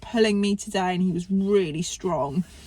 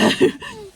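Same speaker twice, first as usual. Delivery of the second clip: brief high laugh; a quick burst of laughter; short laugh; 4 dB louder than usual. Real, not acted. Laughs at the chaos.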